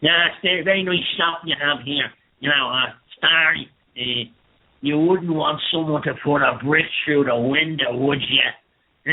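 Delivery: Dublin heavy, sweetly threatening a shopkeeper; put-on Dublin accent, mock racketeer menace. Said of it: "I'll do a Dublin accent".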